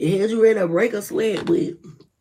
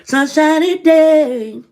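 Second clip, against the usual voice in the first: reassuring; 4 semitones higher, 4 dB louder, slower than usual. Real, not acted. Reassuring viewers.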